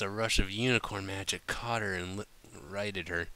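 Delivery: ordinary delivery, nothing marked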